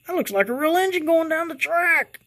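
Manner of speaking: real excitement bubbling up in a high voice; getting excited. Real, not acted. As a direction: gush over the engine like a thrilled fan. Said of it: "I'm getting excited".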